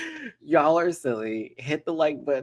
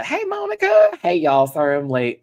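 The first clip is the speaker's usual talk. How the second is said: lively Southern country greeting; country accent; 5 semitones higher, 6 dB louder than usual. Real, not acted. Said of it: "I still have my country accent on".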